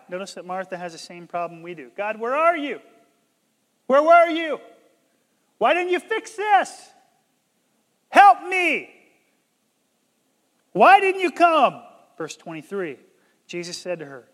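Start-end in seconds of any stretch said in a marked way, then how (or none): 2.0-11.8 loud, high pleading shout; raised voice, desperate and accusing; anguished cry to God. Acted. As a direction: cry out as if demanding an answer from God.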